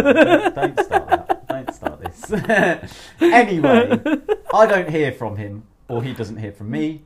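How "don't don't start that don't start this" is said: high, loud playful protest. Real, not acted. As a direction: shout it down laughing. Mock protest, laughing.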